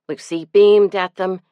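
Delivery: ordinary delivery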